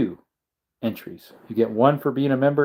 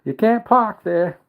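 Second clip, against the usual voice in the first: high, drawn-out Aussie call; mock Australian accent; 9 semitones higher, slower than usual. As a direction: stretch it out like an Aussie kangaroo. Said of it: "two kangaroos go and attack him".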